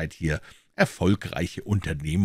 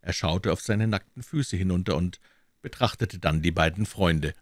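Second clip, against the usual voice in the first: ordinary delivery, nothing marked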